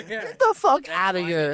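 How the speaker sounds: high, slow, drawn-out disbelief; surprised disbelief. Real, not acted. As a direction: stretch it out in shocked disbelief. Reacting to duck caller claim.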